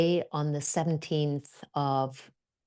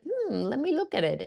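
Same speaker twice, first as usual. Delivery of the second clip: high, musing 'hmm' of interest; retold curious musing; intrigued; 9 semitones higher than usual. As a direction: ponder it aloud in a lifted voice. Acting out husband considering the offer.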